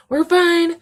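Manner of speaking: loud squeaky outburst of being okay; high, loud insistence. Real, not acted. Recovering from kitten tears, high loud insistence. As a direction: insist brightly, voice shooting way up. Overdone recovery after tearing up.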